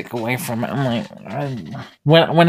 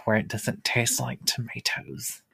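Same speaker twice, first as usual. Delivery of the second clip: whispering; 9 dB quieter than usual. Acted. Confiding aside.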